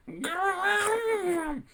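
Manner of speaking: long, high imitation Wookiee roar; warbled fake Wookiee growl. A narrator's reading. Ewok bluffing as Chewbacca to fool Han, high warbled growl. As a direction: warble out a drawn-out Chewbacca cry. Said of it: "with the deepest voice that Kodiak could muster, he warbled".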